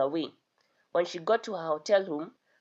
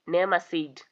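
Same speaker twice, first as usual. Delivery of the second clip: ordinary delivery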